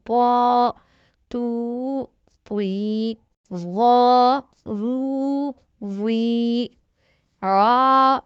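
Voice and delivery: slow, stretched drill voice, each syllable held long; letter by letter, drawn out and distinct; slow spelling recitation. Shown as a demonstration. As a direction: spell it out slowly for a child learning to read.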